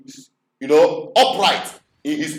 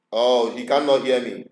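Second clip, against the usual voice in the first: ordinary delivery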